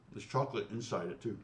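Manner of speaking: talking through a mouthful of croissant; words round a bite of food; mouth full. Real, not acted. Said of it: "I'm talking with my mouth full".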